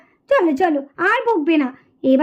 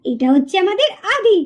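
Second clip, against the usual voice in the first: put-on lower, even storyteller voice introducing the children; story narration; 3 semitones lower than usual. A narrator's reading. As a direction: calmly present the characters to young listeners. (শান্ত গল্প বলার ভঙ্গি)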